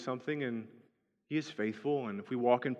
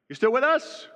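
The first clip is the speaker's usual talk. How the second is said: calling out; 12 semitones higher, 6 dB louder than usual. Retold or imitated. Husband calling out to wife.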